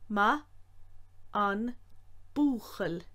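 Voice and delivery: ma an búchail slow with the N sounded; each syllable apart; said slowly. Shown as a demonstration. Teacher showing the full slow form.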